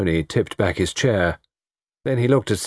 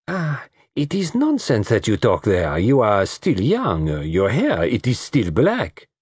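put-on coquettish accented woman, drawn-out phrase ends; flattering South American woman; 3 semitones higher, slower than usual. Lola flattering the older detective about his youth, coquettish. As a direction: coax with slow, swooping stresses.

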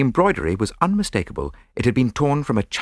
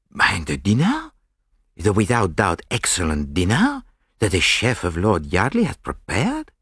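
put-on French accent. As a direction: plead for the dinner in a French accent. Poirot defends the dinner.

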